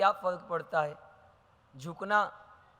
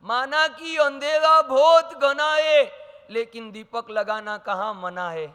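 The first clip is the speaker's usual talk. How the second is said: reciting a poem line; 4 semitones higher, 7 dB louder than usual. Shown as a demonstration. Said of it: "क्या लिखा है?".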